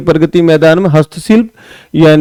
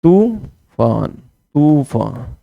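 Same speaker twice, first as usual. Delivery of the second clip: तूफान drawn out slowly and softly, syllable by syllable; slow dictation; 4 semitones lower, 7 dB quieter, much slower than usual. Shown as a demonstration. Teacher writes for students, drawn-out soft dictation. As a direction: spell it out as you write it on the board. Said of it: "वाक्य कैसे लिखेंगे तूफान".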